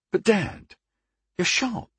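high, pained protest; dismayed. A narrator's reading. Dismayed son protests the closure.

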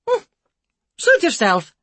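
loud, offended broken English. A narrator's reading. Care worker brushing them off, offended. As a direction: take offence. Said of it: "clearly offended".

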